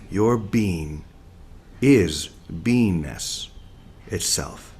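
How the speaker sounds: very slow, each word weighed, fading soft at the end; slow, deliberate reading. Shown as a demonstration. Teacher reading a line to listeners, slowly and softly. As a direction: let it sink in slowly. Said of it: "So listen very deeply".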